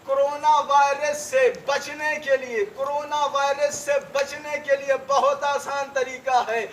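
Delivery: loud, high, fiery majlis oratory; rousing sermon delivered loud and high to the mourners; preaching at full force. Acted. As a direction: hammer each line at the crowd like a pulpit orator.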